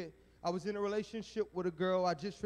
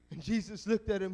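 ordinary talk

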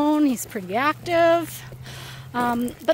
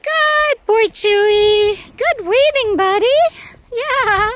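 squeaky praising a dog; 7 semitones higher, 8 dB louder than usual. Real, not acted. Praising dogs.

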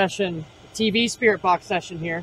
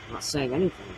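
hushed nervous aside; nervous night vlog whisper; 8 semitones lower, 10 dB quieter, faster than usual. Real, not acted. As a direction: murmur it under your breath. Hushed aside during spirit box.

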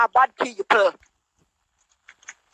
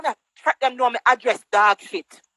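usual speaking voice

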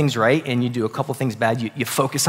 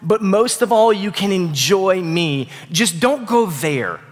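loving plea; 6 semitones higher, slower than usual. Retold or imitated. God lovingly pleading with Adam and Eve.

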